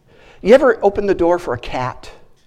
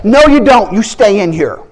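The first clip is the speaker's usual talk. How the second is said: commanding rebuke; 4 semitones higher, 6 dB louder than usual. Retold or imitated. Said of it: "I spoke that to him".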